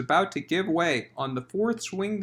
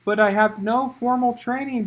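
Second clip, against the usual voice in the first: dismayed; 6 semitones higher, 5 dB louder than usual. Acted.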